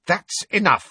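high, firm command; forceful. A narrator's reading. Hermione confronts Fred and George, forceful. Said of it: "Hermione said forcefully".

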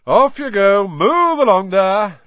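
loud, high, hearty call; booming, drawn-out dismissal; calling to crowd. A narrator's reading. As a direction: shoo them along at full voice. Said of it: "Lockhart called to the crowd".

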